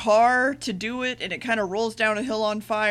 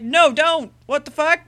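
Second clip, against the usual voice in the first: retold protest; 5 semitones higher, 5 dB louder than usual. Master protesting the doctor's plan.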